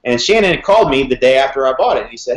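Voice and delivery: ordinary talk, nothing marked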